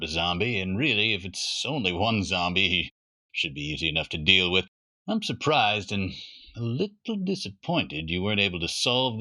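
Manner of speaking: a hint of a gruff father lecturing his son. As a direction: play it as a dad sure of himself. Father voiced in story.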